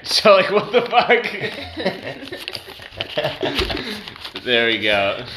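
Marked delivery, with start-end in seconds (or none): 0.0-4.4 genuine laughing outburst. Real, not acted. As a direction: drop the act and laugh it out. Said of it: "That's the genuine energy".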